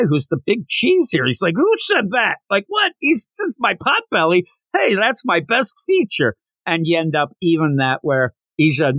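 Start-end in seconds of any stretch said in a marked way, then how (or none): 1.6-6.3 high, indignant who-said-that; offended surprise. Retold or imitated. Slugger defending his pot belly, high and indignant. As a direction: pitch up, taken aback and defensive.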